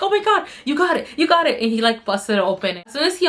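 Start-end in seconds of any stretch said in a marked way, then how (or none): 0.0-1.6 high-pitched excited exclamation; excited, raised-pitch cheering; retold happy excitement. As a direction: relive the thrill of the moment. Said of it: "I was so happy".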